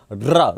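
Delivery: "र" started with a tongue vibration; trilled र. Shown as a demonstration. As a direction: let the tongue tremble into "र". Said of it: "र बोलने में जीवा पहले कंपन करती है". ("र" कंपित जीभ से)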